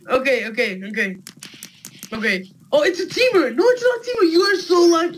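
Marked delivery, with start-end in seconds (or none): 2.7-5.2 high excited cry. Real, not acted. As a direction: shriek with excitement. Excited watching the fight.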